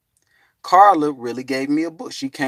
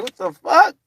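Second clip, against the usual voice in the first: high, rising incredulous cry; can't believe what you hear; stunned disbelief; 6 dB louder than usual. Retold or imitated. Man stunned at being called Enoch, incredulous.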